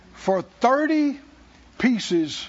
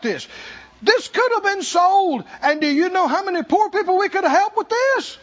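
high, loud mock indignation; preacher imitating an indignant complaint; 8 semitones higher, 3 dB louder than usual. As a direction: play the outraged disciple scoffing at the waste. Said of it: "it with indignation".

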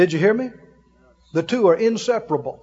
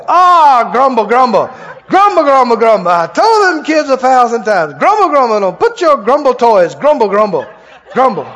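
loud, hammed-up grumbling; mock grumbling; 2 semitones higher, 6 dB louder than usual. Parent who stubbed a toe on a toy, loud mock grumbling. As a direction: grumble loudly like an exasperated parent. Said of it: "hit your foot on a toy".

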